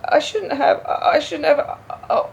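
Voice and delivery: high and loud, close to breaking; anguished, almost in tears; distressed outburst. Acted. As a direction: let the guilt spill out, voice straining up.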